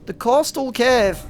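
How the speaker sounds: high, slow uneasy voice; nervous. Real, not acted. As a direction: talk yourself into calm. Entering dark cave nervously.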